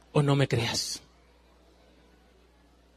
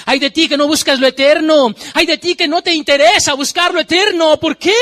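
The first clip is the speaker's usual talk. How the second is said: louder, higher preacher's cry of woe; preaching at full force; 7 semitones higher, 6 dB louder than usual. Acted. Preacher crying woe over those who ignore the eternal, loud and high. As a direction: warn the sinners from the pulpit.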